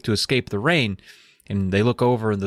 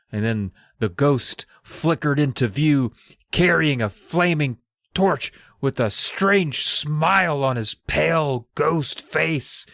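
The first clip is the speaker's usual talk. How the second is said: slow, drawn-out spooky storytelling; dramatic narration; 4 semitones higher, slower than usual. Acted. Narrator unveiling the ghost with the torch, dramatic narration. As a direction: stretch out each phrase for a ghostly reveal. Hammed-up reading of ghost's entrance.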